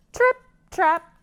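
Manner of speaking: light tiny hooves on a bridge; little hoof steps. Shown as a demonstration. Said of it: "was the sound of his hooves".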